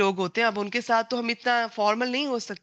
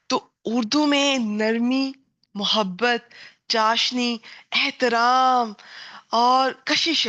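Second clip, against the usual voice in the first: savoured slowly; 2 semitones higher, much slower than usual. Real, not acted. Savouring the qualities of Urdu.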